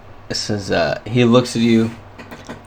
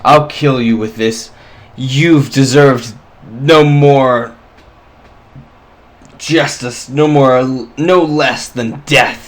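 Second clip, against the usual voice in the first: furious threat; 2 semitones higher than usual. Acted.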